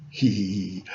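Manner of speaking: low soft sinister snicker; quiet creature chuckle; low gloating giggle. Acted. Dinosaur gloating.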